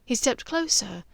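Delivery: usual speaking voice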